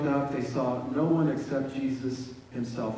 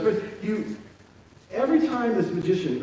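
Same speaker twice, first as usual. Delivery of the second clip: usual speaking voice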